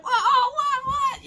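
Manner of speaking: very high, squeaking mock shock; shocked parent. Parent hears the kid's insults, shrieking in mock disbelief. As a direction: let the parent's outrage jump way up. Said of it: "the mother or the father be like".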